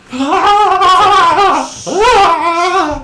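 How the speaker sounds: loud, high, drawn-out scream; screaming victim. Acted. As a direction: scream wildly at full volume. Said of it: "one of them's screaming".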